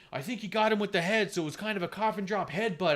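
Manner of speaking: a light take on an excited commentator; imitating Excalibur. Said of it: "Excalibur is all like".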